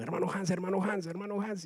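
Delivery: high-pitched imitation of someone calling; imitated calling out. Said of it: "yo vi que lo llamaron".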